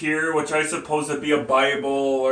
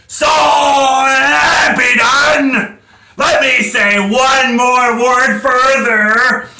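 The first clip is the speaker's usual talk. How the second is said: high, slow, full-throated shout; impassioned shouted declamation; defiant outcry; 9 semitones higher, 7 dB louder, much slower than usual. Acted. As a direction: cry it out to the whole courtroom. Defendant accepts death defiantly.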